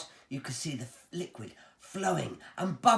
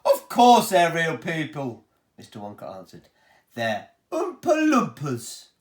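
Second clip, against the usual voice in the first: a proud host showing off his pipes; Willy Wonka voice; 9 dB louder than usual. Acted. Wonka booms to the children about his pipes, showman voice.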